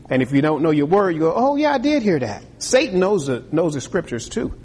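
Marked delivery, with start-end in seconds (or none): 1.3-2.4 high, easily swayed oh yeah; gullible believer. Retold or imitated. Preacher imitating a gullible believer, high and naive. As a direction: play the naive churchgoer who bites.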